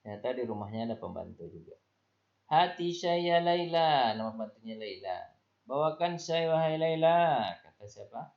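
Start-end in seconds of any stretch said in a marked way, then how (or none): 2.5-4.1 high, loud, slow call to the maid; mother calling for the maid. Acted. 5.7-7.4 mother calling for the maid. Acted.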